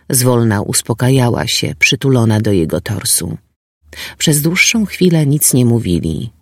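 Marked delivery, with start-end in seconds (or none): none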